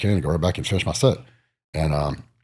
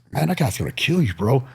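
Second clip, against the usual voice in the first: friend warning; 8 semitones higher than usual. Retold or imitated. Friend voiced in story.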